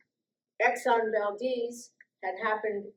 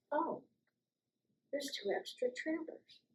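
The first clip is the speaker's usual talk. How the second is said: softer, higher noticing aside; quietly spotting something odd; hushed discovery; 7 semitones higher, 11 dB quieter than usual. Retold or imitated. Undercover investigator noticing extra trailers, hushed.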